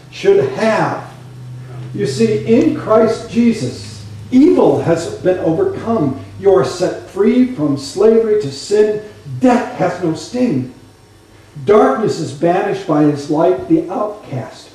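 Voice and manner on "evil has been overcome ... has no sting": ordinary talk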